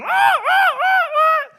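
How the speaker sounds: very high, loud repeated squawk; screaming parrot squawks. Retold or imitated. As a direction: imitate a frantic bird shrieking. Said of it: "kicking and screaming and squawking".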